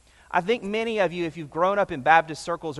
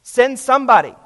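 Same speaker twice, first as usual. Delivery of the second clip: demanding cry; 6 semitones higher, 10 dB louder than usual. Retold or imitated. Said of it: "he cries out and he says".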